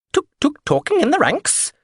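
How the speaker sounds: high, mock-offended scolding; affronted. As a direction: tut at them like an offended governess. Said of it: "Biscuitine sounded affronted".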